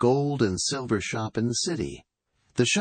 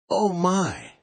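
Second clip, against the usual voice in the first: much higher, astonished "Oh my"; father amazed at the money, much higher voice; surprised exclaim; 11 semitones higher than usual. A narrator's reading. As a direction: gasp it out in amazement. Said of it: "the father exclaimed".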